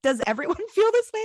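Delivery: high, quick mock-horrified question; squeaky-pitched imitation of someone appalled; horrified disbelief. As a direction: retell it as aghast, half laughing. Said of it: "she was horrified".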